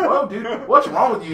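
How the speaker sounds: high, startled mock reaction; high-pitched mock alarm; startled whoa. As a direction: play the shocked neighbor, hands up. Acting out skit, Jordan startled.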